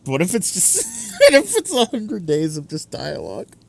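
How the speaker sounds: laughing. Real, not acted. Joking worry, voice pitched up.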